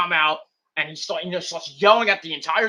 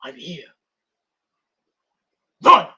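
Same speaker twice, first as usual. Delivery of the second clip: menacing whisper; 7 dB louder than usual. Retold or imitated. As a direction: whisper the threat into the dark. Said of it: "looks at the crowd and goes".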